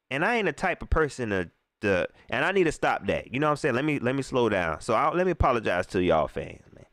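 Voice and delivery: normal talking voice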